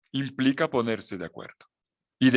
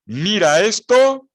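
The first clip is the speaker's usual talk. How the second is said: loud, slow and much higher "Mira esto"; loud exclamation; 8 semitones higher, 10 dB louder, slower than usual. Real, not acted. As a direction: call out for all to look. Preacher exclaims to congregation. (exclamación fuerte y lenta: "Mira esto")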